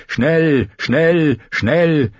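high, drawn-out cry; urgent trumpet call. A narrator's reading. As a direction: intone each word like a distant horn signal. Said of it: "wild und drängend".

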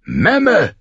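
high-pitched acted outburst; thundered insult. A narrator's reading. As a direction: hurl it contemptuously across the council. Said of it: "donnerte Greyjohn".

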